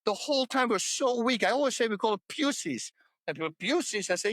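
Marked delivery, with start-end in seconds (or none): 2.3-2.9 PUSSIES said with a "pyoo" first syllable; "pussies" pronounced like the start of pusillanimous; odd pronunciation. Real, not acted. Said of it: "That's why I say it so weird". 3.6-4.1 PUSSIES questioned, with a "pyoo" first syllable; "pussies" echoed with a "pew" sound at the start; odd pronunciation. Retold or imitated. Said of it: "That's why I say it so weird".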